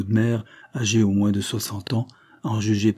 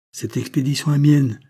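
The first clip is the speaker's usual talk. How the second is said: higher, firm commanding tone; 5 semitones higher, 4 dB louder than usual. A narrator's reading. Wilfrid taking charge as danger looms, commanding. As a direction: claim it with authority. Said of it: "de cette voix de commandement".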